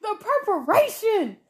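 high, loud, drawn-out exclamation; worked-up, high-pitched outburst; fired-up agreement. Real, not acted. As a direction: cry out in emphatic agreement.

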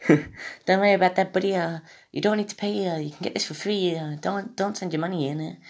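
high, gentle put-on Australian; attempted Australian accent. Podcaster imitating a streamer refusing donations, wobbly Australian accent. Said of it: "should I try an Australian accent?".

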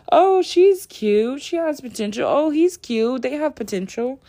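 high, cooing mock infatuation; gushing mock dater. Mimicking daters who let anybody in, high and gushy. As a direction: gush like someone too easily charmed. Mimics people with no self-worth.

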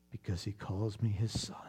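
soft intimate whisper. Real, not acted. Preacher tells the congregation God calls him son, whispered. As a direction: breathe it out as a private truth. Tender sermon climax.

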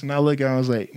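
usual speaking voice

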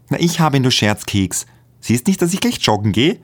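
taunting him with the passport; teasing girlfriend. A narrator's reading. Magdalena teases, holds passport. (neckend, viel höher und lauter)

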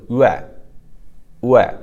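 "yue" from a barely rounded u into e; pinyin ue glide. Shown as a demonstration. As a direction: say "yue" twice as a model, u sliding into e. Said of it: "without rounding too much".

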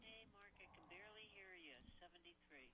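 faint caller far from the phone; very soft, distant phone voice; barely audible. Real, not acted. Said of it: "Turn your phone up".

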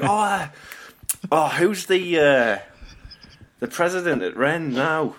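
high, exaggerated Scouse accent. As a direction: ham up the Scouse accent, pitched well up. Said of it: "the way he says it".

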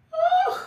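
a high, loud joyful whoop with a laugh; excited whoop. Real, not acted. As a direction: let out a gleeful cry of welcome. Host greets returning live viewers.

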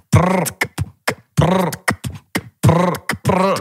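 mock trap ad-lib prr rolled on the lips; rolled trill ad-lib. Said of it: "aprendieras a usar la R".

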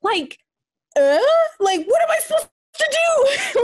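high, rising disbelief into a shout; what am I supposed to do; incredulous. Retold or imitated. Incredulous retelling of insult.

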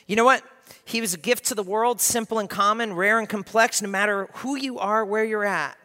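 loud, high, ringing proclamation; high, loud pulpit declaration; full-force preaching. Acted. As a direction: lift the room, preach it out loud.

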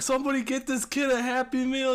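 high, put-on demanding voice; high mock voice; quoting a movie line. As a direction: squeak it out like a fussy customer. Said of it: "he's like".

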